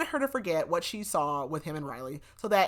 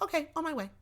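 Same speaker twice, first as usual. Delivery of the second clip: much higher, chirped imitation; mock eager reply; 11 semitones higher, faster than usual. Gunn responds to her text, mock eager. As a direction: pitch it way up, mocking his readiness.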